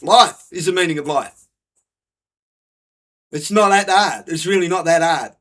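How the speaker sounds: exasperated. Real, not acted. Ranting to viewers, loud exasperated insistence. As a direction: hammer it home like it's obvious.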